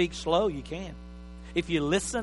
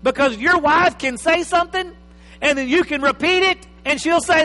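building excitement; 9 semitones higher, 8 dB louder than usual. Acted. Building toward marital quarrel.

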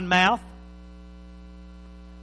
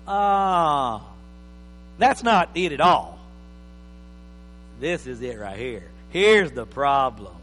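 know-it-all listener; 6 semitones lower, slower than usual. Retold or imitated. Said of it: "and you go".

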